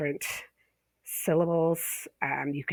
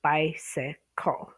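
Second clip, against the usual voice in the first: "bicycle" stretched slowly, beat by beat; slow syllable count; much slower than usual. Shown as a demonstration. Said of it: "the one with three syllables".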